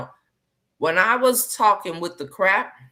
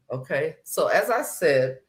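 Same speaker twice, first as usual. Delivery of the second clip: ordinary delivery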